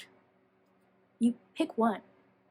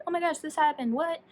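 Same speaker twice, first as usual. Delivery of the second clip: excited disbelief; 6 semitones higher than usual. Retold or imitated. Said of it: "being like".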